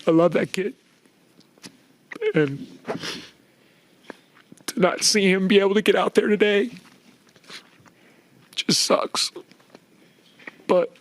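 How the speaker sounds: fighting back tears with long pauses; getting emotional. Real, not acted. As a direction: coach choking up about his injured star player. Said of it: "I'm sorry I'm getting emotional".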